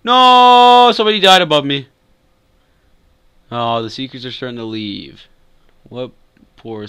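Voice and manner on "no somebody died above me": long, high, loud wail of "no"; gasp, then yell out in alarm; alarmed shout. Real, not acted. Player killed nearby while hiding.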